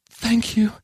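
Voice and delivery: high, tender character voice; high-voiced, understated tearfulness; tearful thanks. A narrator's reading. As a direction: offer it high, close to tears. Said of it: "her eyes welling up with tears".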